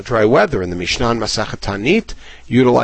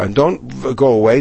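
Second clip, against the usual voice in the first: ordinary talk, nothing marked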